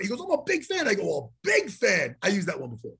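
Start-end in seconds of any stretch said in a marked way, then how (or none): 0.2-0.9 higher-pitched quick fan gush; eager fan. Retold or imitated. Fan's line, then echoed back. 1.0-2.2 low, slow, drawn-out repeat; knowing echo. Retold or imitated. Echoes the fan's words slowly.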